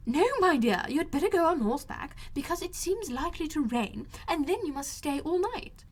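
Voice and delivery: high, agitated mother voice; high fretful matron protesting; fussy Mrs Bennet. A narrator's reading. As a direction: play the indignant mother, pitched well up.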